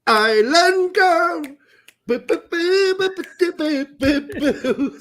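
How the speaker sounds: high, loud Muppet singing boo boo boo; Muppet imitation. Host breaks into a Muppet imitation of the [name], high and loud. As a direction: belt it like a Muppet. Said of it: "That was my Muppet imitation".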